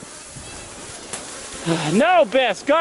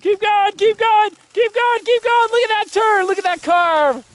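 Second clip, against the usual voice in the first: higher, eager urging; urgent cheering; 7 semitones higher than usual. Real, not acted. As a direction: push them on as they ride. Urging a sledder down the hill.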